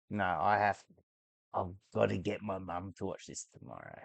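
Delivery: usual speaking voice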